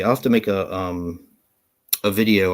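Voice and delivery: normal talking voice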